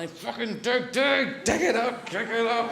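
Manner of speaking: high-pitched, manic urging; frantic digging urgency. Acted. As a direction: act out a mad rush to dig. Frantic speed diggers acted out.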